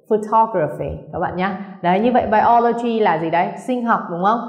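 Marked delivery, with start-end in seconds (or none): 0.1-0.9 photography stressed on TOG; stress on second syllable. Shown as a demonstration. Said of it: "Nhấn vào từ tall".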